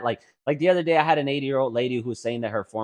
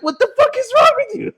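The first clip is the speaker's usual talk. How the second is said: high, loud, half-laughing cry; laughing outburst; 11 dB louder than usual. Retold or imitated. Daughter laughing at mom's reaction. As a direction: screech it through laughter.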